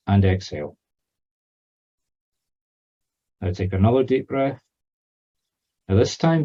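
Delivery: measured meditation voice with long pauses; guided meditation. Real, not acted. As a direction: guide a newcomer through breathing and letting go. Leads a grounding meditation.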